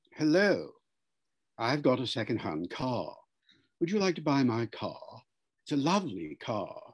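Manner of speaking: neat received pronunciation sales pitch; RP accent. Shown as a demonstration. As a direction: say it in careful standard British RP. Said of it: "R P is getting a bad reaction".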